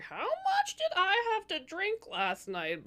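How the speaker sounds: high, slow dazed disbelief; queen waking to find flowers, mock dazed; mock hungover shock. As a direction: play it like waking up after a bender. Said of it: "you're gonna be like".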